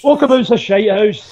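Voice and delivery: high, emphatic scornful jab. Real, not acted. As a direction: scoff at the place loudly. Mocking the pub.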